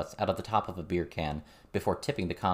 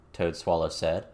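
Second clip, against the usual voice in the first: plain reading voice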